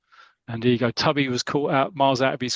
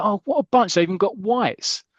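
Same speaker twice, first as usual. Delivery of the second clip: high mock-posh jeer; posh boys; 8 semitones higher, 3 dB louder than usual. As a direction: sneer at them in a posh schoolboy voice. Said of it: "that's my impression of a posh boys".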